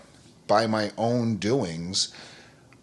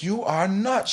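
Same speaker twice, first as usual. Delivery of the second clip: a higher, mock-amazed friend; lightly voiced friend in disbelief; incredulous coworker; 10 semitones higher, slower than usual. Coworker reacts to yoga mat.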